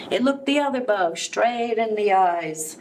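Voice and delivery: ordinary delivery, nothing marked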